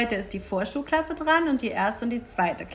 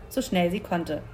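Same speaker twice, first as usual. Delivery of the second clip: ordinary delivery, nothing marked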